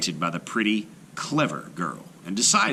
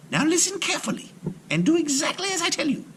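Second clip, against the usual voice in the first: put-on much higher, quick, bright young girl; girl's voice; faster than usual. A narrator's reading. Aisha coaching her father, quick high girl voice. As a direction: hurry him along with a clever plan.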